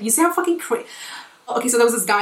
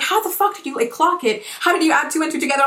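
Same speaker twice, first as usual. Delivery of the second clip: friends amazed, high; 6 semitones higher than usual. Retold or imitated. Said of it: "my friends go like".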